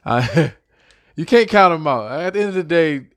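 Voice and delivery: real laugh breaking through. Real, not acted. Fan laughing while talking about Redskins. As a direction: giggle through you can't count 'em out. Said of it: "I'm trying not to laugh".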